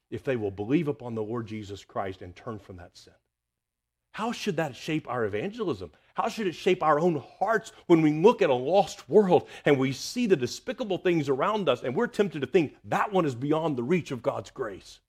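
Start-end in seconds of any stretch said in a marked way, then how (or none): 4.1-15.0 louder, higher, pressing preacher's appeal; rising pulpit intensity; forceful preaching. Acted. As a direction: drive the question home to the congregation.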